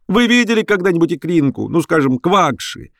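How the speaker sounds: high-pitched indignant questioning; irate shout. A narrator's reading. Professor challenging the young reporter, irate and high. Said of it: "закричал Персиков".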